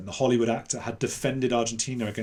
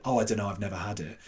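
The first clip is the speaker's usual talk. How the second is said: deadpan joke; 3 semitones lower, 3 dB quieter, faster than usual. Retold or imitated. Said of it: "as a joke".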